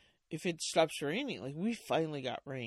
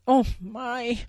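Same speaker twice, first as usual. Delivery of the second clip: louder, higher mock fret; a hammed-up fretting bear; 7 semitones higher, 12 dB louder than usual. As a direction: worry aloud theatrically. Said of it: "starting to fret".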